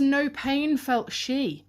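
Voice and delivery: "No pain felt she" slow and high, NO stressed with a falling tune; slow, stressed quote. Shown as a demonstration. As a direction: lay weight on "No" in a slow, high reading. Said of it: "subverted syntax".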